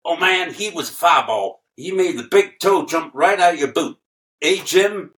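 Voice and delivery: [name]. Retold or imitated. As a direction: ham up [name] praising a fireball. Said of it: "and he said".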